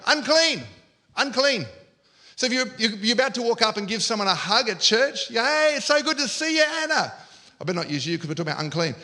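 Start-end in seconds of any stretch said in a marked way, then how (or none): 0.1-1.7 mild, higher called-out warning; yelled warning. Acted. Unclean woman warning those around her, mild yell. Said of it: "she has to yell out every day". 5.3-7.1 higher, hammed-up hello; gushing greeting. Churchgoer about to hug a friend, gushing greeting.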